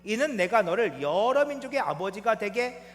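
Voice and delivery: loud, declamatory Bible verse; scripture reading. Acted. Preacher leading the congregation in a verse, loud and declaimed. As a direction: proclaim the verse, ending on a forceful amen.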